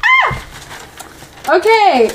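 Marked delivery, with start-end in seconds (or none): 0.0-0.4 very high short squeal; tiny high-pitched shriek; delighted squeal. Real, not acted. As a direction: squeal at something cute. Squeal at the cute ending.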